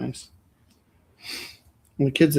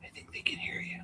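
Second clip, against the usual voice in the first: whisper; 17 dB quieter than usual. Real, not acted. Whispered warning.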